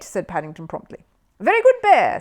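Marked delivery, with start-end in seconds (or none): 1.4-2.2 high, loud, approving praise; bright praise for the clever bear. A narrator's reading. Host praises Paddington, approving and loud. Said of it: "said Ronnie Playfair approvingly".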